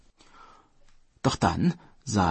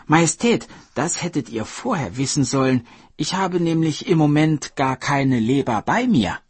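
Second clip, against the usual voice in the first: a hint of a sly hare, louder; cunning hare; 8 semitones higher, 6 dB louder than usual. Hare tricking the Dragon King, slyly confident. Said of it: "sagte der Hase".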